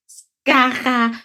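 high, halting stammer on the name; a sparrow trips over a hard name, stammering. A narrator's reading. Sparrow Chilp stumbles over the beetle's name, stammering. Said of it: "stotterte Chilp".

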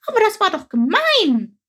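high, loud, heated protest; a sparrow flares up in outrage; indignant outburst. A narrator's reading. Sparrow Chilp gets worked up about the story, indignant. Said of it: "ereiferte sich Chilp".

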